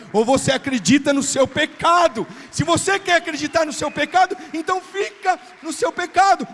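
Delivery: loud, driving sermon voice; full-force preaching. Acted. Pastor preaching to the church at full force. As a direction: preach it hard to the congregation.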